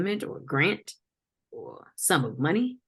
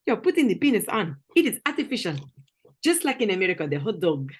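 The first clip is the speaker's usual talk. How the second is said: higher-pitched mock African accent; African interrogator, accusing and incredulous; 5 semitones higher than usual. Confronting someone over found belongings, mock African accent.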